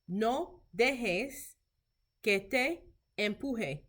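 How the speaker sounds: measured phrases with a pause midway; slow language model. Shown as a demonstration. Teacher modelling Spanish for clinicians, slow and careful. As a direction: say it slowly for learners to repeat.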